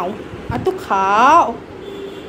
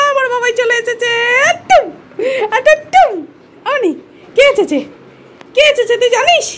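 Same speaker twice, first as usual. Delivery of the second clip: excited pet talk; 12 semitones higher, 3 dB louder than usual. Real, not acted. Telling the pet daddy is home, excited baby talk. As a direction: coo excitedly in a high voice.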